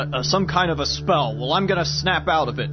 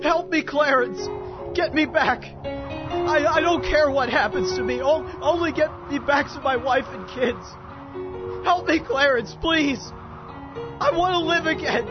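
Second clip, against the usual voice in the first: high, broken-up begging; anguished plea; 5 semitones higher than usual. Acted. George begging his angel to give his life back, anguished. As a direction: implore heaven, slowing in despair.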